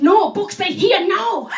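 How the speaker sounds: slow, loud, high and stubborn; insistent broken English. Retold or imitated. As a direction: tourist shouting her guidebook is right.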